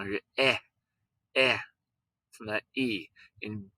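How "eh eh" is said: a drawn-out short eh, the vowel in best; vowel sound demo. Shown as a demonstration. Teacher to class, isolating the eh vowel. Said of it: "I hear one vowel sound".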